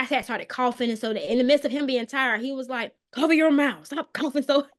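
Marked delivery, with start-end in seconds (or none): 3.1-4.4 higher, louder mock scolding; scolding husband. Husband scolding his coughing wife, loud and bossy. 4.4-4.7 laughing. Real, not acted. Laughing while recalling.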